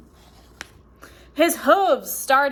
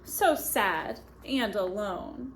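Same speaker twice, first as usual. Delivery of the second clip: sad; 5 semitones lower, 7 dB quieter than usual. A narrator's reading. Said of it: "He never felt so sad".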